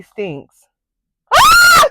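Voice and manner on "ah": very high, loud shriek; piercing frightened scream; real scream. Real, not acted. Screams as it crunches.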